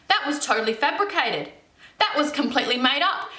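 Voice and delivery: ordinary delivery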